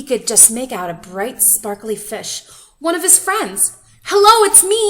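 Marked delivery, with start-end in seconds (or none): 4.0-4.8 very high, loud call. A narrator's reading. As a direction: shout out to a friend far away. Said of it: "he called".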